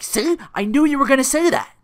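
high, loud burst of I-told-you-so; exclaiming in vindication. Retold or imitated. Phil pouncing on Rita's remark, loudly vindicated. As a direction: pitch it up and make it loud.

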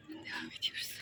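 soft whispered aside; whispering. Real, not acted. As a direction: whisper it quietly. Vlogger moving on quietly. (fısıltıyla)

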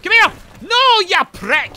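very high, loud frustrated shout; angry combat yell. Real, not acted. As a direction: scream at a fleeing enemy. Yells at game enemy.

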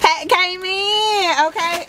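high, slow, drawn-out call; playful drawn-out holler; sing-song yell. Real, not acted. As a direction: belt the announcement out high. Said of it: "Ooh, why am I yelling?".

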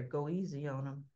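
soft, low murmur into an ear; hushed, lightly voiced aside; retold whisper. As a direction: imitate a whisper, softly and low. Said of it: "she whispers in his ear".

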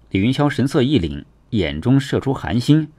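ordinary delivery

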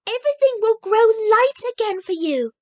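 put-on high, bright child voice, earnest; a small girl promising with full conviction; eager little girl Heidi. A narrator's reading. Heidi hopes for grandmother.

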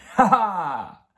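loud, high "ha, ha!"; playful acted laugh. A narrator's reading. Dad surprising mum from behind, laughing playfully. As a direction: burst into a big performed laugh. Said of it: "zaśmiał się tata".

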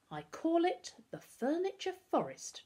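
lifted, high and proud announcement; grand. A narrator's reading. Darcus showing off the yard to his friends, grandly. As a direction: present it like a showman. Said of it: "Darcus said grandly".

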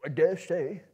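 a sour dwarf grumble; grumbling Bombur. A narrator's reading. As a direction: fat dwarf grumbles back at the wizard. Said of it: "grumbled Bombur".

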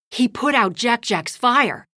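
ordinary delivery, nothing marked

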